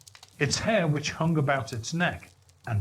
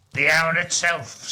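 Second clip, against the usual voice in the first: triumphant; 4 dB louder than usual. Acted. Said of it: "said Scrooge triumphantly".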